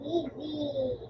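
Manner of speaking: soft, calm reassurance; soothing. Real, not acted. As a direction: settle them down gently. Soothing after tension.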